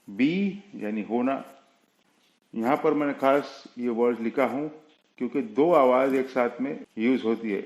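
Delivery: ordinary talk, nothing marked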